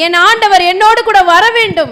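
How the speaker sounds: very loud, high rallying shout; loud declaration. Real, not acted. Interpreter leading the congregation to ask God along, loud declaration.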